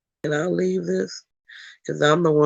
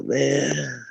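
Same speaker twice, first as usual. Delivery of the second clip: ordinary talk, nothing marked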